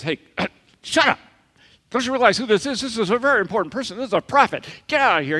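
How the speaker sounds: high, loud scolding shout; angry crowd. Retold or imitated. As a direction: shoo the beggar away, indignant.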